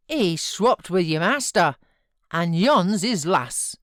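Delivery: put-on a loud, broad old servant voice; blunt old servant. A narrator's reading. Joseph judges the boy to his master, loud and blunt. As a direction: grumble it like a blunt farmhand.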